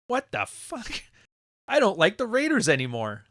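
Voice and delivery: high, startled mock outrage; mock disgusted fan. A Raiders fan seeing the cheerleaders, high mock disgust. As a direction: recoil like a let-down fan.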